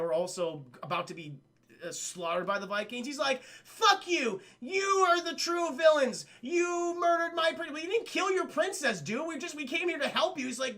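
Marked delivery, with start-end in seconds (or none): 3.4-7.7 high, loud hammed-up indignation; imitated furious denunciation; mock outraged accusation. Acting Malgus accusing the gargoyles. 7.7-10.5 high-pitched defensive reply; exasperated, imitated objection; mock protest. Acting gargoyle's protesting reply.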